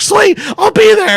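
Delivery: patient thrilled the other hospital has room; relieved excitement. Retold or imitated. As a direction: jump at the chance. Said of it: "I'm like".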